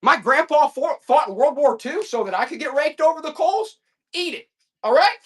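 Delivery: outraged farmer sounding off; fired-up rant. Real, not acted. Fired-up rant about grandpa.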